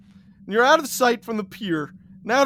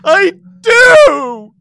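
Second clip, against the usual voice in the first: high, loud, desperate cry; crying shout; 11 semitones higher, 15 dB louder than usual. Acted. Boy begging for forgiveness, crying shout. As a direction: scream it through sobs. Tearful answer.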